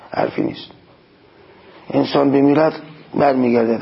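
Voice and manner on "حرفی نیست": حرفی نیست breathed as a whisper; whispered aside. Real, not acted. Preacher concedes a point to congregation, whispering.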